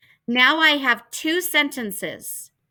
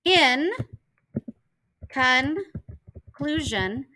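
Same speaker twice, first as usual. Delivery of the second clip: slow reading-aloud; 2 semitones higher, much slower than usual. Shown as a demonstration. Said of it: "it says".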